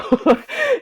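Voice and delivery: high, loud laugh; a sudden high giggle; burst of laughter. Real, not acted. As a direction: let a real laugh break out. Laughing at her own words.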